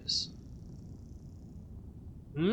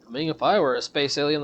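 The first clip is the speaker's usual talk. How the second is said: normal talking voice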